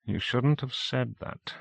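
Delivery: quiet, slow reproach; softly, slowly. Acted. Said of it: "said Howie softly".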